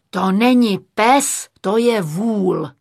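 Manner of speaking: loud, drawn-out exasperation; director falling apart. A narrator's reading. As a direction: lament it loudly, at the end of patience. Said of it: "Hroutil se režisér". (zoufalý režisérův povzdech)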